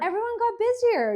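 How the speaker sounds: high, loud burst of agreement; emphatic agreement. Real, not acted. Co-host seizing on the host's explanation, loud emphatic agreement. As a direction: jump in loudly, pitched right up.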